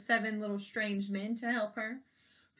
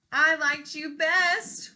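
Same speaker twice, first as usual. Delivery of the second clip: slow, higher, loud whine; whiny plea; 6 semitones higher, 8 dB louder, slower than usual. Acted. Queen following Paulina, whining. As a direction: whine like a spoiled child. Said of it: "whined the queen".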